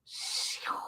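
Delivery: soft breathy whoosh; falling whoosh. Retold or imitated. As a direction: breathe out a quick falling shoo. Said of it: "when it just goes".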